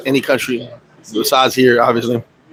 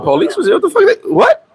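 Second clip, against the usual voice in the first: shocked disbelief; 14 semitones higher than usual. Retold or imitated. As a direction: voice jumps high on What the fuck. Shocked at police visit.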